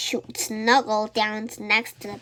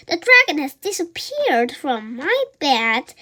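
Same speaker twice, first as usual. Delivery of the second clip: ordinary delivery, nothing marked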